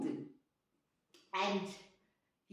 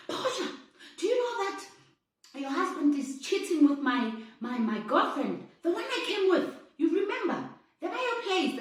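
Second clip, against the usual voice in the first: much higher, rushing the gossip out; pitched up and urgent, spilling the news; excited tattling; 6 semitones higher, 4 dB louder than usual. Retold or imitated. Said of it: "And he was like".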